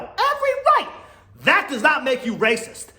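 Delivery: high, insistent stress; emphatic high. Real, not acted. Ranting about Kyle, a high insistent stress. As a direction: drive the point home.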